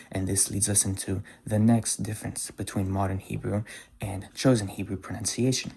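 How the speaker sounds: ordinary delivery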